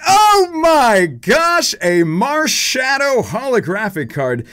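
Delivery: very high, loud, slow shriek; thrilled cry. Real, not acted. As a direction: squeal it out in disbelief. Shocked at holo pull.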